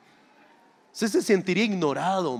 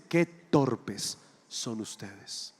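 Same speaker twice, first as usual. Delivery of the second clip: slow low rebuke; 9 semitones lower, 6 dB quieter, much slower than usual. Acted. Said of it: "Jesús los mira y les dice".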